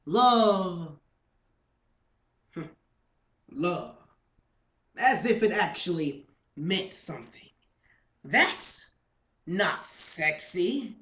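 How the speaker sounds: loud, slow, emphatic slam poetry; mock-indignant poetry rant; spoken-word performance. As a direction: stretch key words and pause dramatically between them.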